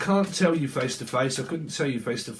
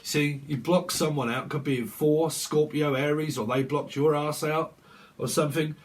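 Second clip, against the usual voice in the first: usual speaking voice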